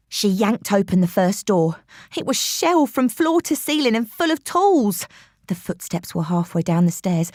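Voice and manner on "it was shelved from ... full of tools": ordinary delivery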